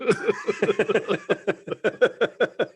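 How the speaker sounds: long high real laugh. Real, not acted. Recalling the fancy dress stag night, real laughter. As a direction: crack up at the memory.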